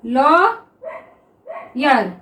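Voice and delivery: Lawyer drawn out very slowly, each sound held; slowly stretched word. Shown as a demonstration. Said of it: "देखिए". (Lawyer को बहुत धीरे खींचकर बोलें)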